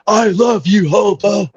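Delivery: imitating wrestler. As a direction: ham up a wrestler catchphrase. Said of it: "he's gotta do".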